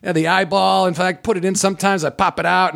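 high, breezy easygoing man; cheerful uncle. Retold or imitated. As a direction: casually show off the eye. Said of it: "he's like".

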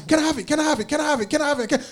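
put-on high nagging child, repeating the plea then giving in; mock toddler pestering a parent for a toy; whiny child begging. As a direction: beg over and over like a spoiled kid, then cave. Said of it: "like a child just wants something".

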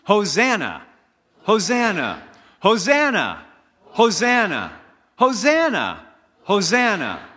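high, loud, ringing cries of praise; proclaimed with gusto. Shown as a demonstration. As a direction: proclaim it with joy for the people to echo. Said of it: "say it back to me with some amount of proclamation and gusto".

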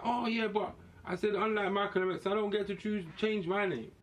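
ordinary talk

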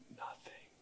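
barely-there whisper; hushed whisper. Acted. Preacher reports that Baal gives no answer, whispering. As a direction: drop to a whisper for the anticlimax. Hushed anticlimax after the loud cry.

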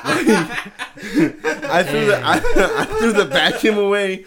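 loud, high, laughing hard while talking; real laughing. Real, not acted. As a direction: crack up through the words. Teller cracking up at own story.

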